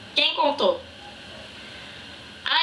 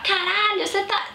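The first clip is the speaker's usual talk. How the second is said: imitated yell; 9 semitones higher than usual. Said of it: "começou a gritar".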